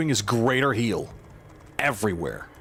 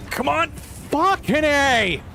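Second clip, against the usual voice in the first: high, slow exasperated cry; exasperated groan; 13 semitones higher, 3 dB louder, slower than usual. Real, not acted. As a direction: drag it out in exasperation. Frustrated gamer losing a fight.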